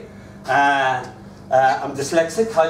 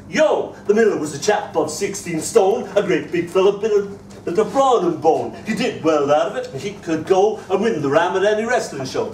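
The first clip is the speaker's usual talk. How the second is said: loud, bouncy recitation with a raised pitch; boisterous, rhythmic storytelling in verse; rollicking verse; 5 semitones higher, 4 dB louder than usual. Acted. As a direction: belt out the rhyme with gusto. Boy performing Chaucer's Miller with gusto.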